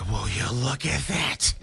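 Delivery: slow, high, amazed foster mom. Retold or imitated. Dad imitating the amazed foster mom, slow and high. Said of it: "she's like".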